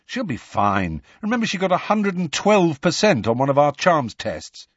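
lightly voiced boy, rising in pitch; reassuring schoolboy friend. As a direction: reassure a friend with growing insistence.

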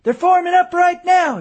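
high, loud alarm call. A narrator's reading. Young soldier in a tree warns the colonel, loud and high. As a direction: shout the warning down from the treetop. Boy calls warning from tree.

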